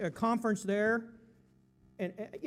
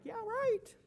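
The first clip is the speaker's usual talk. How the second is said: high, mocking yeah right; sarcastic disbelief; 13 semitones higher, 3 dB quieter than usual. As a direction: scoff at the idea, pitched up high. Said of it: "I'm like".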